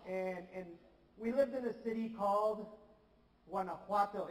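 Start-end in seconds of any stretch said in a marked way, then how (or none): 3.5-4.3 Guanajuato uitgesproken als wah-nah-HWAH-toh, met een zachte h. Shown as a demonstration. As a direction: Guanajuato op zijn Spaans, met de klemtoon op HWA. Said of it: "It's very fun to say".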